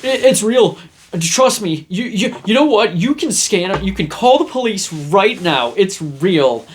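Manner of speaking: hammed-up defensive plea; nervous overinsisting. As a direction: push too hard to be believed. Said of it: "If I would have been like".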